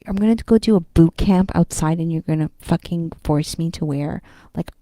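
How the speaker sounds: loud, rising frustrated outburst; a ranting complaint about being forced to wear a mask; exasperated rant. Real, not acted. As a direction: vent loudly at the rule.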